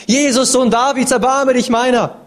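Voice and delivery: hoch und laut flehend; rufend. Acted. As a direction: schrei um Erbarmen. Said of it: "er ruft so laut er kann". (flehender Ruf)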